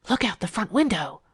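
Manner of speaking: ordinary delivery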